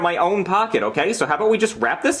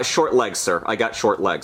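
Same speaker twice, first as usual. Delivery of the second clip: usual speaking voice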